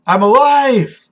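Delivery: high, loud exclamation, held in check; excited cry, short of screaming; elated realization. Acted. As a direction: declare it with rising joy. Revived character realises she lives.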